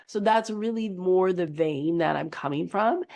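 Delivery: ordinary talk, nothing marked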